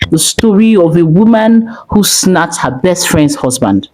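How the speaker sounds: ordinary delivery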